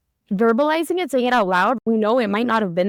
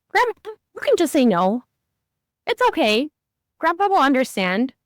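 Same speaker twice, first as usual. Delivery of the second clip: higher, lightly voiced little boy reassuring; a hint of a child's voice, kind and sure; kid reassuring grandpa; 6 semitones higher than usual. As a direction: echo a sweet six-year-old comforting grandpa.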